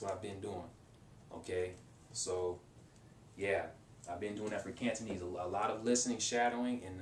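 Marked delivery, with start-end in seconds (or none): none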